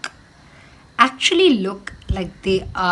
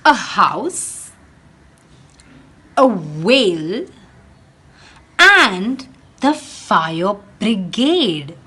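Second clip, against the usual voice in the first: lively storybook read-aloud for a class; animated picture-book reading; reading to children; 5 dB louder, slower than usual. Acted. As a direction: read it like storytime for little ones. Teacher reads picture book aloud.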